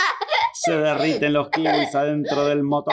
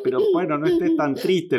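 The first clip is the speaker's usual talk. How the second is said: a hint of a kindly fire chief; fire chief consoling. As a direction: fire chief, higher start, comforting. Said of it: "le dice el jefe de los bomberos".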